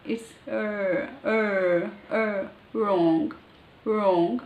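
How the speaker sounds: "R" and "wrong" with the tongue not touching anything; English R demo. Shown as a demonstration. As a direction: hold the tongue in the middle for "R". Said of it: "the tongue is not touching".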